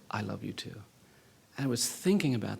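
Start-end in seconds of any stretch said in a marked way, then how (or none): 0.1-0.8 low, much softer, tender reply; retold soft whisper. Little boy hugging back, murmured in his ear. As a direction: imitate a child's hushed reply, voiced softly. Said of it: "whispered flawlessly in my ear".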